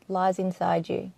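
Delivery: usual speaking voice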